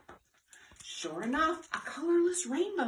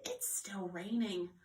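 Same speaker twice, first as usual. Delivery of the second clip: soft slow whisper; 5 semitones lower, 6 dB quieter, slower than usual. Acted. Elmer whispering to the birds about the rain. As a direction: breathe it out as a hushed aside.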